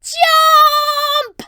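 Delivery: high, loud sudden shout. Acted. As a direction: yell it as you leap. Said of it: "Red grasps hold of my hand and shouts".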